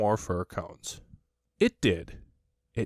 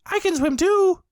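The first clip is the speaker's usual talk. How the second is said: very high, loud proud squeal; high squeaky joey voice; proud Roo; 7 dB louder than usual. A narrator's reading. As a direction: boast like a tiny kangaroo. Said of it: "said Roo proudly".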